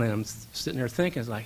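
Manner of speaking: ordinary talk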